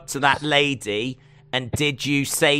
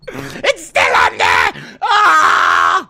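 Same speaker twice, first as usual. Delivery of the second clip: hammed-up high panicked wail; backstage panic; 11 semitones higher, 5 dB louder than usual. Crew seeing court case still on screen, mock panic. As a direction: scream it in mock despair. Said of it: "What I just reenacted there".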